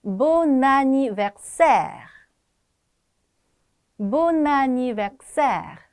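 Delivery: Bon anniversaire slow, firm and held out, linked; linked pronunciation. Shown as a demonstration. Teacher repeats the joined phrase for students, slow linked pronunciation. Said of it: "다시 연결해서 발음해 볼게요".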